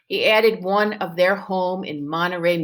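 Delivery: ordinary talk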